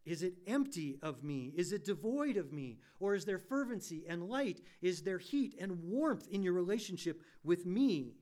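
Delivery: loud, rising, impassioned pulpit voice; preaching at full force. Acted. Pastor warning his church about empty lives, preaching loud and impassioned. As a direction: drive it home to the congregation.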